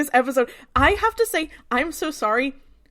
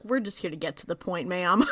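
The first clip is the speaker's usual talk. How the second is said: agent voice; 6 semitones lower, 6 dB quieter than usual. Retold or imitated. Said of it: "they're like".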